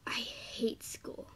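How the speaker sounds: hushed, slow whisper; whispered captions. Real, not acted. Reading her drawings' captions in a whisper. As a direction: whisper them like secrets.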